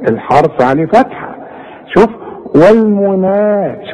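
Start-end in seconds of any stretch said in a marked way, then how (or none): 2.5-3.9 والمنا with the alif held two counts, slow; drawn madd. Shown as a demonstration. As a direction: hold the long a in والمنا for two beats. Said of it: "شوف المد". (مد الألف في والمنا حركتين)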